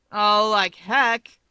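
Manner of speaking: slow, loud scoffing snap; snapping. A narrator's reading. Toya snaps back at Hawks in disbelief, slow and loud. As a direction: snap it out, dragging it. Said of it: "Toya snaps".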